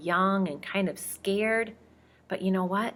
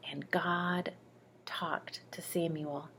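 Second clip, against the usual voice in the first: whisper; 9 dB quieter, slower than usual. A narrator's reading. God speaking quietly to Samuel.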